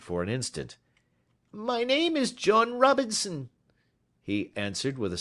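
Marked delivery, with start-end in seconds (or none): 1.5-3.5 high, anxious little man's voice; a jittery clerk trying to sound eager; high-pitched nervous visitor. A narrator's reading. Ryder gives false name.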